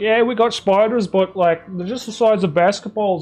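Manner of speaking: hammed-up Aussie accent, selling spiders as no big deal; mock Australian accent. Streamer spoofing an Australian tourism ad, mock Australian accent. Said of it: "I don't know what this accent is".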